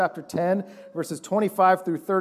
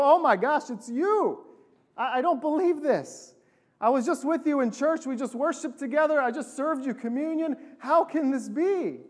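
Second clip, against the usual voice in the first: higher-pitched, acted disbelief; shocked disbelief; 7 semitones higher than usual. Pastor finds a church member lying beaten, shocked disbelief. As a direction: react in mock shock, pitched up.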